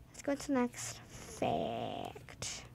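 slow breathy whisper. Real, not acted. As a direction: whisper it slowly. Repeated in whisper.

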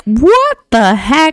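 high, drawn-out mock shock; hammed-up appalled kid; appalled son. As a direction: stretch it out in high disbelief. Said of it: "He's like".

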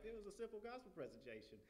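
high-pitched, quick puzzled protest; baffled parents. Retold or imitated. Parents not understanding why he cried, high baffled voice.

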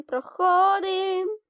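high, slow singsong проходим; playful slow lilt on проходим; drawn-out sing-song. Real, not acted. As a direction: stretch проходим up high like a tune. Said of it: "sung-out repeat".

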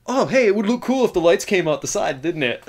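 lightly voiced airheaded filmmaker, rising high; mock filmmaker. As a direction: parody a shallow creative idea. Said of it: "basically this".

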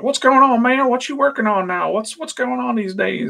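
high-pitched, excited faux-hillbilly impression; Robert Kirkman. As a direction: greet a pal as an eager fake hillbilly. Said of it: "kinda like a faux hillbilly".

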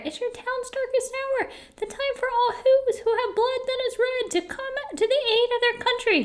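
tiny high-pitched rallying speech; mayor crying out. A narrator's reading. Mayor calls all Whos to their country's aid, proclaiming. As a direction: proclaim gravely in a small, high voice. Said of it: "cried the mayor".

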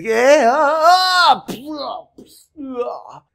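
high, loud surprised screams; hammed-up cries of pain; goddess screams. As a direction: shriek as she's hit from behind. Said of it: "la diosa dice".

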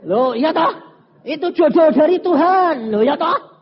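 high, loud emphatic protest; mock insistence. Preacher parodies lovers claiming a God-given match, mock insistence. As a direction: proclaim it with exaggerated conviction. Mocks lovers' grand declarations.

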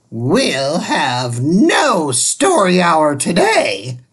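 slow, grim stern decree; witchy teacher. Acted. The strict substitute cancels story hour, slow and grim. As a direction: Miss Swamp denies story hour. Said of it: "said Miss Swamp".

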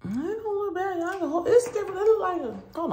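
high squeaky voice. Real, not acted. Surprised at the lip result.

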